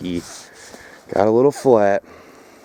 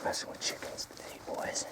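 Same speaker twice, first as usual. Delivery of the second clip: hushed whisper so the chickens don't hear; a low hush near the coop; 22 dB quieter than usual. Real, not acted. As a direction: whisper it, sneaking up close. Sneaking up on the chickens.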